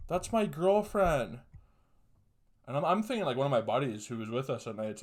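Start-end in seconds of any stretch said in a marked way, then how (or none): 0.1-1.4 high, slow, raised voice, not yet full force; indignant call. Retold or imitated. Boyfriend at the window, calling out slow and raised. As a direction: call it out slowly, higher and louder, still holding back. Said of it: "And I hear this guy go".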